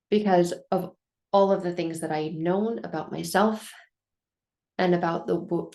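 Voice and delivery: ordinary talk, nothing marked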